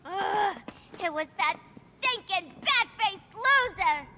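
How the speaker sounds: loud seething insult; angry shouting. Acted. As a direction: spit the insult in fury. Harley raging.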